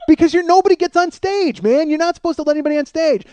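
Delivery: high, loud and agitated; worked-up protest. Retold or imitated. Guard protesting that the stage rule was broken, worked-up protest. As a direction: insist on the rule at the top of your voice.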